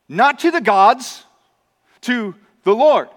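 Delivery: slow, weighty stress on Lord; slow, emphatic correction. Acted. Preacher stressing to his congregation, slow and weighty. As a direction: press the word Lord firmly.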